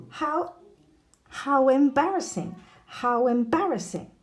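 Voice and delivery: high-pitched "how embarrassing" with a w glide before em; linked W sound. Shown as a demonstration. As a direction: join How to embarrassing as how-wembarrassing. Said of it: "the W with the vowels".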